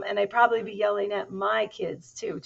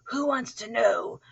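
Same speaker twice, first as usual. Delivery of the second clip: slow, louder gruff challenge; growled challenge; 4 semitones higher, 5 dB louder than usual. A narrator's reading. Hidden dog challenging a stranger, mild growl. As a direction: lightly growl the question, stretched out. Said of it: "growled a voice from inside".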